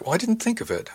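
high-pitched self-defence; defensive. A narrator's reading. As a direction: protest it, voice jumping up. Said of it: "I defended myself".

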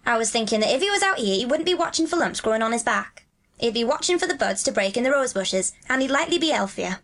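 broad Yorkshire dialect, lilting; Dickon, friendly country lad. Acted. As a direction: chat like a Yorkshire moor boy. Voices Dickon in dialogue.

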